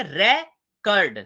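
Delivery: Record split slowly as RE...kard, first part strong; slow, loud RE-kard for record; first-syllable stress. Shown as a demonstration. Teacher showing students record, slowly stressing the first syllable. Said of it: "पहले पे focus करेंगे तो क्या कहेंगे".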